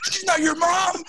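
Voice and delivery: high, squeaky-pitched mock outburst; intense Riley. Podcaster mimics Riley denying Adam's mother, intense high mock outburst. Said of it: "immediate intense reaction".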